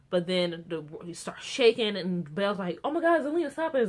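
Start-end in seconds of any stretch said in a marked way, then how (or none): 2.8-3.9 mock alarm. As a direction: act out a startled plea, pitched up. Acting out Belle's panicked plea.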